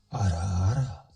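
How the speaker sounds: deep voice changer ara ara. Shown as a demonstration. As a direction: drop ara ara low through a voice changer. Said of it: "I found my voice changer".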